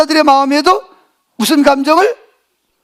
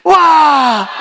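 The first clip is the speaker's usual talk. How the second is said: long, open cheer on cue; shouting on request. Shown as a demonstration. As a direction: shout it out in one drawn-out burst. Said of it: "소리 좀 한번 질러봐요". (시작 신호에 맞춰 길게 내지르는 함성)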